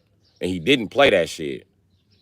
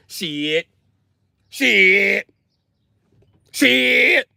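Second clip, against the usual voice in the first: mock exclamation; 11 semitones higher, much slower than usual. Real, not acted. Repeated exclamation, rising.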